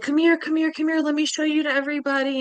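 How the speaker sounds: high, rushed excitement; imitated enthusiastic summons; eager beckoning. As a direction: hurry her along to show her off. Woman eagerly pulling her along.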